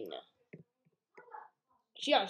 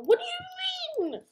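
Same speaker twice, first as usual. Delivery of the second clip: very high surprised cry; surprised squeak; 4 dB louder than usual. Real, not acted. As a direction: squeak out the protest. Sudden dismay in game.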